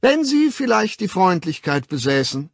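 high, loud, overly polite request; timidly placating. A narrator's reading. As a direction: coax a dangerous man with courtesy. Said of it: "Mit der zaghaften Freundlichkeit dessen". (begütigend, überhöflich)

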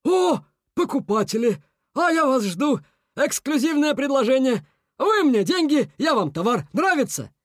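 high-pitched eager huckster; joyful salesman beaver. A narrator's reading. Beaver greeting a crowd of buyers, joyful sales pitch. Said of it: "радостно потёр лапы".

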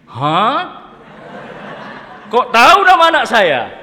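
loud, high startled outburst with a laugh; a shocked father demanding how he knows; surprised father. Acted. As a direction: blurt it out, caught off guard. Startled father questions the suitor.

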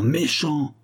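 rising tearful "méchant!"; tearful reproach rising. Acted. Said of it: "dont les yeux rougissaient de larmes".

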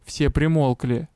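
plain reading voice

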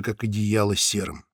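usual speaking voice

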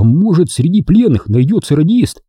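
lifted, eager voice of a comrade; sudden hopeful idea. A narrator's reading. Genka blurts out sudden idea.

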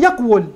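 "يقول" said yaq-wul; underlying form. Shown as a demonstration. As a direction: consonant waw after a closed qaf in "يقول". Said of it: "واو مضمومة قبلها قاف ساكنة".

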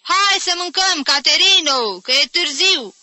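high, loud hollered invitation; calling from outside. A narrator's reading. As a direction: call through a window to come and eat. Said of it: "strigă tanti Agepsina de afară".

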